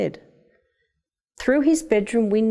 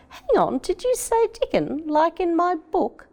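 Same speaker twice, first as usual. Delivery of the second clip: much higher, astonished question; surprised boy; 11 semitones higher than usual. A narrator's reading. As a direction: catch on to the name in amazement. Boy notices the medal name.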